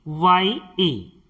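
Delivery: letters spelled out slowly, one by one; slow teacher spelling. Shown as a demonstration. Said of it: "इसे हम पढ़ेंगे बाय".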